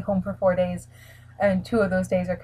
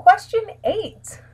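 announcer voice; 12 semitones higher, slower than usual. Acted. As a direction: announce like a game show. Announcing the next question.

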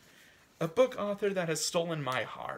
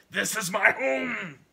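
full-force, high-pitched shout; old man's shout; 7 semitones higher, 10 dB louder, slower than usual. Acted. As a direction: bellow it in defiant outburst. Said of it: "the old man shouted".

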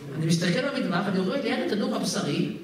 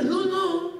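impatient mock nudge; 10 semitones higher, much slower than usual. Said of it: "ואני עושה".

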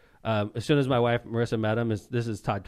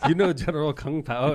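laughing quote; 6 semitones higher, 5 dB louder than usual. Retold or imitated. Quotes the documentary interviewer.

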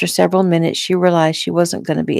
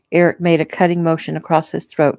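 ordinary delivery, nothing marked

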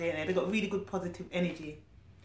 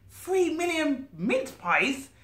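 amazed; 7 semitones higher, slower than usual. Real, not acted. Reader amazed aside.

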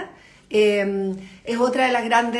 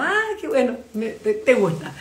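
pleased surprise. Retold or imitated. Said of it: "uno se siente como".

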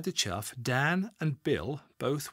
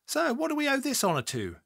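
woman's voice. A narrator's reading. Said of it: "she asked".

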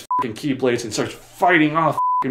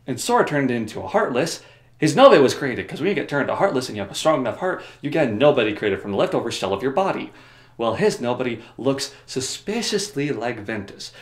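ordinary delivery

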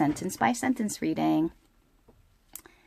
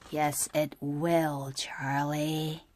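ominous hush; 4 semitones lower, 8 dB quieter, slower than usual. Acted. Lola answers ominously.

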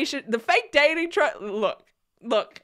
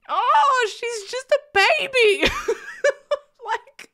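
gushing squeal; 12 semitones higher, slower than usual. Real, not acted. Fan gushing to viewers about Cruz, squealing. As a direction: gush like she's too precious.

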